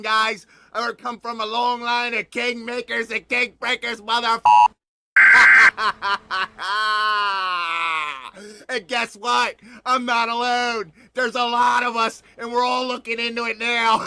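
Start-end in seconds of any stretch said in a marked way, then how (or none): 5.1-8.6 big booming cackle that breaks into a shout; loud cackle. Real, not acted. Host bursting into a cackle after his boast, booming. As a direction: laugh out loud, triumphant and unhinged.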